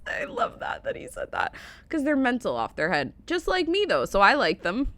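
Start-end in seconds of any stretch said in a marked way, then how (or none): 0.0-1.5 high, breathless laughing words. Real, not acted. Host delighted by the kid's catchphrase, laughing. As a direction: squeal through the laughter.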